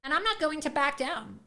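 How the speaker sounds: ordinary delivery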